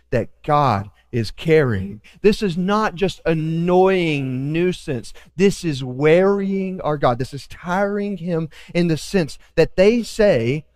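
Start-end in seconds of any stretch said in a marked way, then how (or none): none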